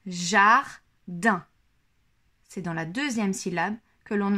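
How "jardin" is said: "Jardin" split into "jar-din", louder, each syllable apart; syllables counted out. Shown as a demonstration. Teacher shows where the D sound falls, "Jardin" split slowly. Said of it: "dans quelle syllabe il entend le son D".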